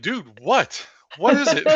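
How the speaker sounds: high, loud and laughing; retelling a startled friend, breaking into a laugh; excited alarm. Retold or imitated. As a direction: blurt it out, half laughing in alarm. Startled by partner's reaction.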